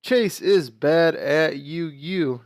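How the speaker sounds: normal talking voice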